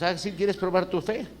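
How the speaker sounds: playful challenge. Acted. Jesus replying to doubting Pedro, high-pitched challenge. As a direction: lift the voice into a challenge.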